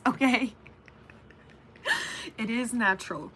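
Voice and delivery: normal talking voice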